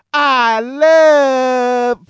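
high, loud, drawn-out preacher catchphrase, cut short; Brother Love. Retold or imitated. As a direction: launch a hammy preacher cry, high and loud. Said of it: "Fucking Brother Love".

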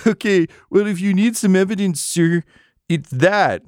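high, laughing through the words; barely holding in hysterical giggles; hysterical laughter. Acted. NPC cracking up before the interrogators, laughing high. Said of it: "breaks out into, like, vaguely hysterical laughter".

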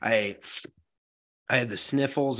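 stuffed-up nose from a lingering cold; blocked nose. Real, not acted. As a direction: talk through a plugged nose. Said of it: "It's still plugging my nose".

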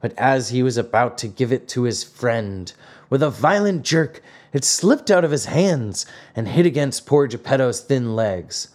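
ordinary delivery

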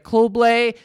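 put-on slow, mangled French with an American accent; an American tourist fumbling a French order in an American accent. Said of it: "putting it in, like, an American accent".

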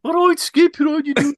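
loud, high Brummie caricature; Brummie accent impression. Local in a Birmingham pub greeting Tom Brady, mock Brummie accent. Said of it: "they start talking like that".

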